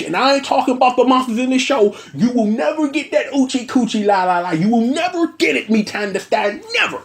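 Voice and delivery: hammed-up taunt at the idol chick; cocky Me Tan the Stan. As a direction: strut it like a smug fanboy.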